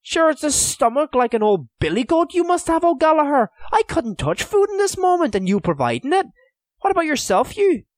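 very high, loud disbelief; incredulous. A narrator's reading. Donal feels too sick to eat, incredulous protest. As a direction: scold him in amazed protest. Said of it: "said Donal's voice incredulously".

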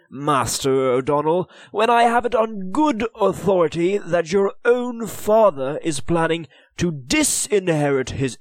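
high, loud, imperious Lord Deputy; haughty lord. Acted. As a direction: press him with lordly scorn. Lord Deputy presses the boy.

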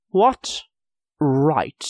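high, outraged disbelief; shocked echo. A narrator's reading. Merchant appalled, echoing Hugh's words in shock. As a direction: repeat it as if you can't believe it.

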